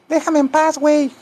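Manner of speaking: high falsetto grumpy cat; talking cat. Pretend. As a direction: play an annoyed cat in a squeaky voice. Voices the cat answering him. (gato fastidiado en falsete)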